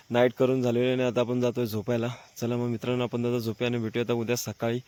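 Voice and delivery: hushed so others can sleep; quiet at night. Real, not acted. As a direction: keep it low, people are sleeping. Night camp, others asleep nearby.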